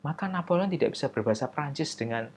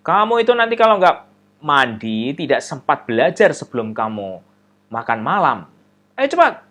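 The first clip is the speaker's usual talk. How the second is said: scolding mother; 3 semitones higher, 9 dB louder than usual. Acted.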